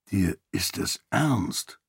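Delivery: quiet, slow, worried question; hushed and uneasy; growing worry. A narrator's reading. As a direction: ask it softly, slowly, dreading the answer. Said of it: "mit wachsender Besorgnis".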